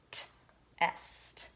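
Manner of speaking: "t" then "est" whispered as sounds; syllable sounded out. Shown as a demonstration. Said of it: "the next syllable is".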